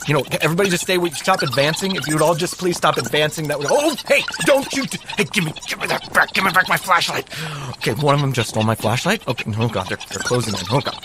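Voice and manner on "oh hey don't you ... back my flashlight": higher, panicky protest; hammed-up scramble to get it back; mock alarm. Host pretending a raccoon stole his flashlight, mock panic.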